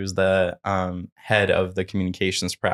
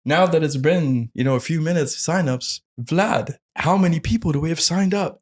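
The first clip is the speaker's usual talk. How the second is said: put-on higher-pitched amateur Australian accent; [name], Australian accent; 7 semitones higher than usual. Presenter asking Vlad for signup numbers, imitated Australian accent. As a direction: put on your best Australian host voice. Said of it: "my best Australian accent".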